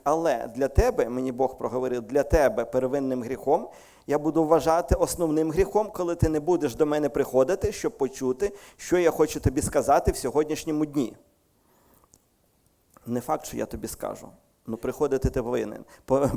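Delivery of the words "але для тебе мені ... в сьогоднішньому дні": louder, pressing sermon voice; fervent preaching. Acted. Preacher passing on God's demand with fervour. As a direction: urge them on hard.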